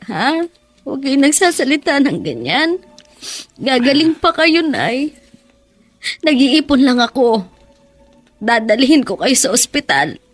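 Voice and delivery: tearful pleading. Acted. Daughter pleads with dying mother.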